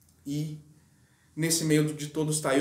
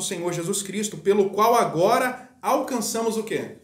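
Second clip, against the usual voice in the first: higher, louder preaching, pressing the point; fervent preaching; 4 semitones higher, 4 dB louder than usual. Acted. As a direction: preach it out over the congregation. Preacher rising to full force. (pregação fervorosa, mais alta e mais forte)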